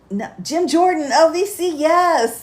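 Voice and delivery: triumphant name recall; excited recall. Real, not acted. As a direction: shout it out the moment you remember.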